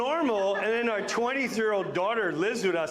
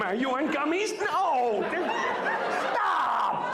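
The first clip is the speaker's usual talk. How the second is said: teasing daughters; 8 semitones higher, slower than usual. Retold or imitated.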